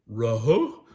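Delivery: loud cartoon dog voice; Scooby agreeing. Scooby agreeing to sneak some pudding, loud dog voice. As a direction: agree like a talking dog, punched out. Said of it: "Scooby agreed".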